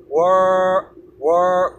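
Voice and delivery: "Wor" alone with an English r, no l or d; first half of world. Shown as a demonstration. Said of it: "ワールドも同じで". (舌を後ろに置いたまま英語発音で「Wor」)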